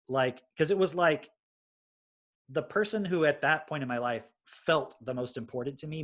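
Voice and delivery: normal talking voice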